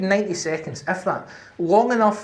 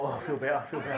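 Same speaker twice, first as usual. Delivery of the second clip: softer, lower relieved murmur; relieved sigh; 5 semitones lower, 10 dB quieter, faster than usual. Retold or imitated. Seeing the paramedics arrive, soft relief. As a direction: breathe out relief quietly.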